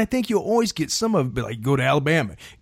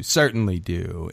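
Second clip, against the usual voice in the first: much lower and slow ghoulish voice; spooky echo; 12 semitones lower than usual. Pretend. Agrees with the host in a slow spooky voice. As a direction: drag it out like a crypt keeper.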